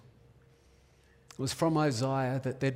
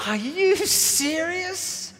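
put-on high, slow, wide-eyed little girl's gasp of wonder; amazed child; 12 semitones higher, 3 dB louder, much slower than usual. Little girl asking her dad, amazed. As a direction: let the disbelief stretch out like a small child.

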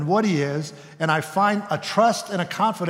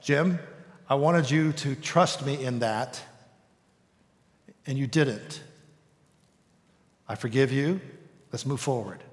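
gentle Holy Spirit; 3 semitones lower, 4 dB quieter than usual. Acted. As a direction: speak gently, like forgiving a friend. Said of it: "the Holy Spirit would say something like that".

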